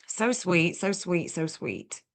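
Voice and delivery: fading from soft to barely there; touched. Real, not acted. Streamer touched by a viewer's gift, softening to a murmur. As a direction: melt away with each so sweet.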